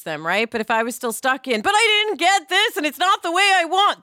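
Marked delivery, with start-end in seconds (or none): 1.6-4.0 high, loud bratty whine; little brat. Retold or imitated. Imitating a bratty kid who didn't get her way. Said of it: "that's actually how I'd sound, like a little brat".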